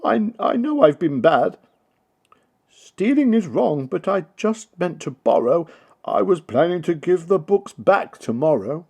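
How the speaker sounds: put-on the book thief explains himself to the children, high and pleading; remorseful thief. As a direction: beg forgiveness like a caught thief.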